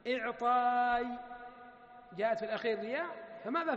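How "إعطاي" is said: إعطاي stretched slowly, half-sung; إعطاي drawn out in a chanting teacher's voice; drawn-out demo form. Shown as a demonstration. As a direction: sing out إعطاي slowly to show the final ya. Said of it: "فهنا أعطى يعطي".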